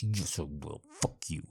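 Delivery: ordinary talk, nothing marked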